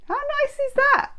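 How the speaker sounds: high squeaky critter voice; prairie dog. Shown as a demonstration. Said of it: "That's my prairie dog impression".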